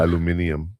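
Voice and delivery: "aluminium" said al-yoo-MIN-ee-um; British pronunciation. Shown as a demonstration. Contrasting it with the American word, British pronunciation of "aluminium". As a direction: give "aluminium" its extra English syllable. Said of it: "That's how they say it in England".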